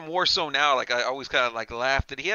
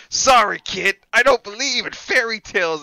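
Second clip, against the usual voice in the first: higher, louder sneering villain voice; Freddy taunt; 5 semitones higher, 5 dB louder than usual. Retold or imitated. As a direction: sneer it as the killer lifts the kid. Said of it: "and he goes".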